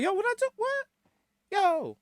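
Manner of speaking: high-pitched mock innocence; startled protest. Customer to security with hand on chest, protesting. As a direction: squeak out an offended denial.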